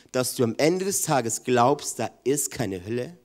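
ordinary talk, nothing marked

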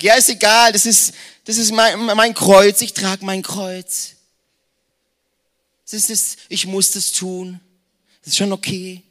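hoch und laut, dann gedehnt leidend; Märtyrerpose. Pretend. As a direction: spiel den übertriebenen Dulder mit Kreuz. Parodierter frommer Dulder. (ein frommer Christ ziert sich leidend vor Gott)

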